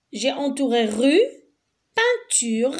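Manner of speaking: ordinary talk, nothing marked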